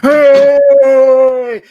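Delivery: long, loud cheering hey. Real, not acted. Host greeting actress as she joins, cheering. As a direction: draw out a big welcoming cheer.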